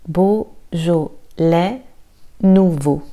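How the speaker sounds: "Beaujolais" drawn out very slowly, S silent; slow syllable drill. Shown as a demonstration. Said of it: "Le S est silencieux".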